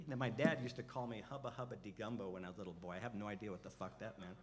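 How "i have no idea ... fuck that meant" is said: ordinary talk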